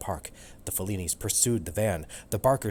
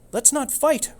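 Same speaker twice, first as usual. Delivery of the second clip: much higher, louder pleading cat voice; 8 dB louder than usual. Acted. Cat Romeo begging Turbo not to fight, high and pleading. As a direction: beg him to stop, high and urgent. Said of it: "Romeo pleaded with Turbo".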